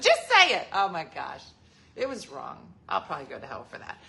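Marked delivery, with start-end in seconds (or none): 0.0-0.7 high, loud, laughing demand; mock insistent command to grandma. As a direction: push her to say it. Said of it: "I said".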